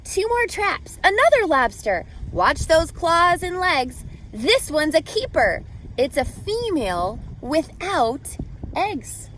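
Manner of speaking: ordinary delivery, nothing marked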